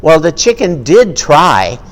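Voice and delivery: usual speaking voice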